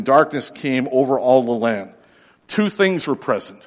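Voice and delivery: ordinary talk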